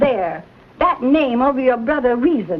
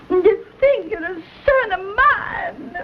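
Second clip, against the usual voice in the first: higher, crying reproach; tearful outburst; 6 semitones higher than usual. Acted. Mother reproaching her son for disowning kin, crying out in hurt. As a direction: let the hurt break into a tearful cry.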